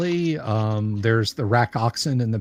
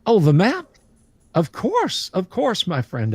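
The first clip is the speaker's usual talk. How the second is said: high, eager merchant voice; eager trader voice; 6 semitones higher than usual. Acted. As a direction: play the obliging trader, pitched up. GM voicing eager trader NPC.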